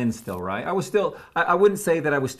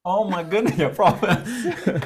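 laughing; 6 semitones higher, slower than usual. Real, not acted. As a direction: let the words ride on real laughter. Laughing at the friend's jailbreak joke.

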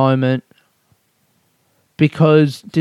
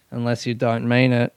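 ordinary talk

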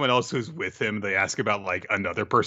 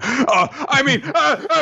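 much higher, loud flustered stammer through laughter; panicked backpedal; 7 dB louder, slower than usual. Retold or imitated. Mourner accidentally confessing at the funeral, laughing panic. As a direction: laugh while covering a slip.

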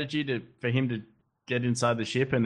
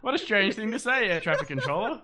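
higher, laughing through the words; laughing rant; 9 semitones higher, 4 dB louder than usual. Retold or imitated. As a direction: giggle while mocking. Mock pilot rant while laughing.

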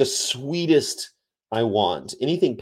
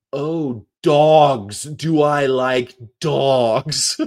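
loud, higher, slow drawn-out "dogs"; mock dawning realization; 4 semitones higher, 6 dB louder, much slower than usual. Acting the scene in Snatch, exaggerated loud realization. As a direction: boom out "dogs" slowly as it finally clicks.

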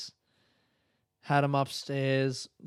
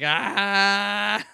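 excited crowd cheer; 6 semitones higher, 6 dB louder than usual. Retold or imitated. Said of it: "they were like".

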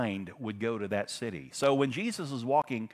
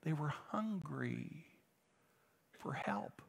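very soft, drawn out, sinking to a whisper; hushed intensity; 4 semitones higher, 11 dB quieter, slower than usual. Real, not acted. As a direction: let the need hang in a slow hush. Dwells softly on their need.

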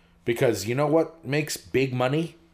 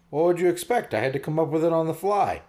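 higher-pitched, put-upon joke character; indignant mite punchline; 6 semitones higher than usual. Acted. Host telling a fly joke, voicing the mite's high protest.

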